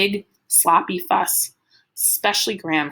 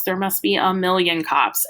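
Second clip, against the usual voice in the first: ordinary delivery, nothing marked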